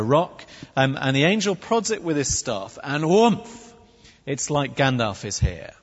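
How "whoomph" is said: sudden vocal whoomph of flames; imitated fire whoosh. Shown as a demonstration. Angel prods the offering with his staff, fiery whoomph. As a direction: make the noise of fire bursting up.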